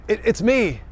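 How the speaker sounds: ordinary talk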